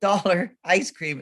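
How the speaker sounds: put-on slow, high child's insistence; imitated little kid. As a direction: repeat it slowly like a stubborn kid. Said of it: "the kids all".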